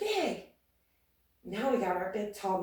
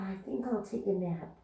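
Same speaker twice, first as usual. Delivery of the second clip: usual speaking voice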